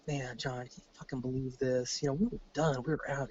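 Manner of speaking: retold quiet grumble to a pal; hushed aside. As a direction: say it softly, half under your breath. Retold private joking with a buddy.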